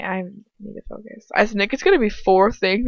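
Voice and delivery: ordinary delivery, nothing marked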